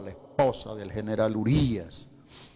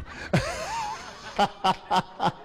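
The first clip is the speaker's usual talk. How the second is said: high, burst of laughter. Real, not acted. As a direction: laugh hard at your own joke. Laughs at crocodile tears joke.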